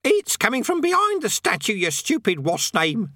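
very high, squawky parrot voice; croaking parrot. A narrator's reading. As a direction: scold like an irritated parrot. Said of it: "it croaked".

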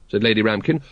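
ordinary delivery

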